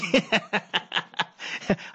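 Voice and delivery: a short, soft chuckle; soft laugh. Real, not acted. Host laughs at the Den Hausa aunties, a soft chuckle. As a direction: laugh it off quietly.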